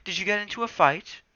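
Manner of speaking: high, loud cat voice; Smudge voice. Acted.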